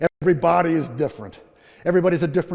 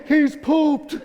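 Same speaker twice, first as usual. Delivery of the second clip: high, jubilant announcement with laughter; hammed-up group celebration; mock team cheer; 9 semitones higher than usual. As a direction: proclaim it like comic triumph. Whole team cheers, acted.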